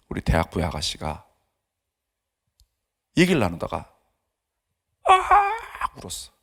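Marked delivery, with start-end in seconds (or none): 5.0-5.9 high, drawn-out mock cry; imitated wail. Preacher mimicking a young woman bursting into tears, mock wailing. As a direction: let out a long, high wail. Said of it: "그냥 운 것도 아니고 아 울었어".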